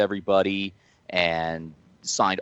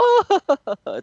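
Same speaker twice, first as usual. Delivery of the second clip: loud, very high mock cackle; imitation of mom's laugh; 6 dB louder than usual. Mom laughing at the wrestler's onion-breath line, imitated high laugh. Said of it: "my mom does the whole".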